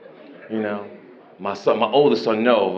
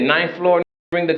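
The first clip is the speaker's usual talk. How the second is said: normal talking voice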